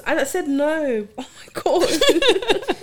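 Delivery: slow, high words dissolving into laughter; laughing confession. Real, not acted. Owning up to friends about the jacket, laughing. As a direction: break up laughing as you admit it.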